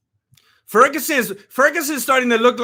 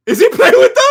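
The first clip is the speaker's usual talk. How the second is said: much higher, louder squeal of disbelief; excited disbelief; 14 semitones higher, 9 dB louder than usual. Real, not acted. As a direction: shriek the question, can't believe it. Repeats question in disbelief.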